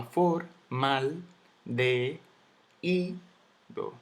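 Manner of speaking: teacher's split-up syllables. Shown as a demonstration. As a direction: count out "for, mal, de, ido" with a pause after each piece. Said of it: "Ahí ya hay tres sílabas".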